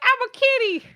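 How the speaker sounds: put-on high cutesy kid voice; kitty voice. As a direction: squeak it like a little kid. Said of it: "They're like".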